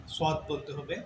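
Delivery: usual speaking voice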